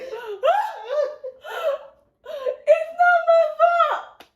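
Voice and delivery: very high, wailing, slow crying protest; child bawling to her mother, high and slow wail; mock sobbing child. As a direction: cry out your innocence like a caught kid. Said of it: "I'm just sitting here like".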